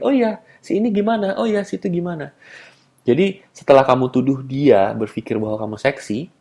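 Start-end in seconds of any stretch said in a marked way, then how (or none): 0.0-2.3 higher-pitched offhand question; casual topic change. Acted. Man ignoring her denial to change the subject, casual offhand question. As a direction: switch lightly as if nothing happened. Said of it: "lalu bicarakan topik lain".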